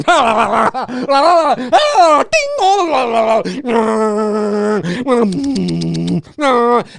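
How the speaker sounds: imitating a theater full of people chatting on phones; mock crowd babble. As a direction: babble like a roomful of phone talkers.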